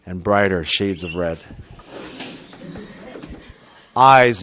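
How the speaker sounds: low, slow narration for the folks at home; deadpan documentary narrator, low and deliberate; mock hushed commentary. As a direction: solemnly narrate the suffering, drawing out key words.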